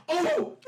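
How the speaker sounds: sudden high yelp of pain. Acted. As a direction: yelp as the locker slams on the hand. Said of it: "he goes".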